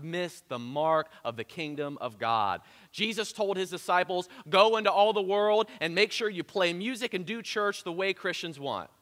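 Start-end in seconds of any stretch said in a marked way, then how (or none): 4.5-8.9 mock misquote.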